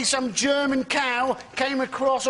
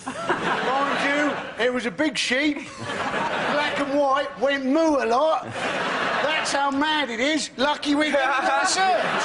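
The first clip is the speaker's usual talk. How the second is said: clueless researcher; 2 semitones higher, slower than usual. Retold or imitated.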